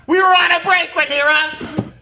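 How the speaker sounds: high, loud sitcom protest; Ross Geller impression. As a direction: wail it like an aggrieved sitcom boyfriend. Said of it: "pull the old, uh, Ross and Rachel".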